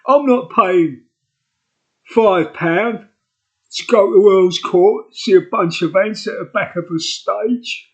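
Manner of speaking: mock London Cockney; phony Cockney accent. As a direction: ham up a Cockney geezer. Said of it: "That's me phony Cockney accents".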